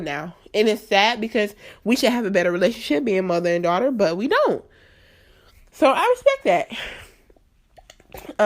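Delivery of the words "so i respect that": getting emotional. Real, not acted. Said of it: "sorry, I'm getting all emotional".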